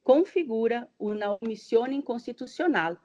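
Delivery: ordinary talk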